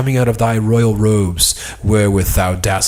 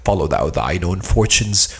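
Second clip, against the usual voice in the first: ordinary delivery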